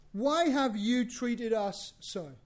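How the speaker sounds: each word drawn out slowly; slow, pointed reading of the mother's question; slow emphasis. Shown as a demonstration. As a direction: lean on every word so it lands. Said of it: "and note this".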